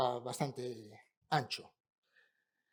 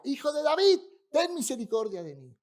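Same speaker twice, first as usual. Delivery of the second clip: higher, louder pleading cry; insistent shout; 10 semitones higher, 6 dB louder than usual. Retold or imitated. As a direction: keep crying out, won't give up. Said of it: "E insistía".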